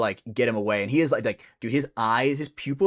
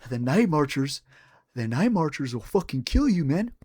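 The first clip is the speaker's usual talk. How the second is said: imitated twitchy warning, pitched slightly up; mock a crackhead's serious warning with a mouth tic; crazy-lipped crackhead; 3 semitones higher than usual. Storyteller re-enacting crackhead's warning, lightly voiced lip-tic impression. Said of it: "he would do something, like, crazy with his lips".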